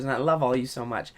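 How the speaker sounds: low, soft, lightly voiced smooth podcaster outro; a hint of a soft-spoken podcast host; mock podcast host sign-off. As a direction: ease into a gentle, hushed sign-off as a parody. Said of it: "I don't talk like that".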